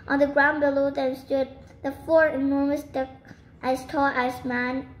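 ordinary delivery

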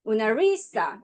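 "모나리사"의 "리"에 강세; "모나리사"에서 "리"를 힘주어 세게. Shown as a demonstration. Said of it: "세 번째". ("모나리사"의 "리"를 세게)

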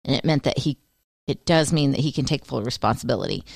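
usual speaking voice

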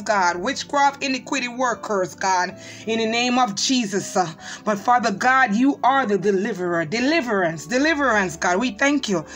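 ordinary talk

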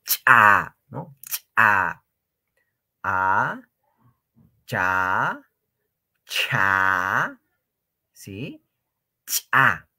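lightly exaggerated cha with a low onset. Shown as a demonstration. Teacher modelling the sound for students, exaggerated. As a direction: drill cha slowly and clearly. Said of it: "yo estoy exagerando".

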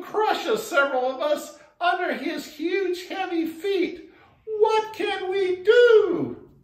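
small frogs crying out for help; pleading frog chorus. Acted. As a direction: beg the wise toad in a high piping voice. Frogs plead to wise toad.

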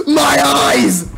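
high-pitched cartoon yelp; mock pained cry. Cartoon pirate squirted with mustard, high mock pained cry.

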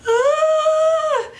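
high, loud held "ah" cry; overwhelmed gasp. Retold or imitated. Retelling the proposal to viewers, a loud high ah. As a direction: relive the shock of the proposal. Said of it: "and I'm just like".

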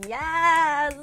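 high, drawn-out yes; excited cheer. Real, not acted. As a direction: cheer with real glee. Cheers at manga shopping plan.

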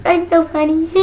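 ordinary talk

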